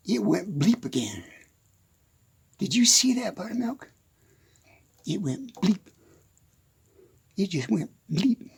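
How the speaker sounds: hushed, startled whisper. Real, not acted. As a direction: whisper it to the cow. Startled by phone noise, whispering.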